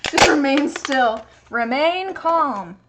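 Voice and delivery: calm the cat like a hostage negotiator, slowly; mock calming. Real, not acted. Soothing the cat.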